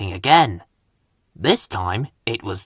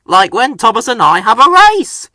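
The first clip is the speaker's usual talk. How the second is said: higher and louder bus voice; eager Bertie; 9 semitones higher, 7 dB louder than usual. A narrator's reading. Bus chiming in about racing, higher and louder. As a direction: bounce in eagerly. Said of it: "said Bertie".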